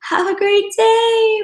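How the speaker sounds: high, slow sing-song cheer; mortified overly sweet goodbye; awkward cheery farewell. Retold or imitated. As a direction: chirp it to cover embarrassment.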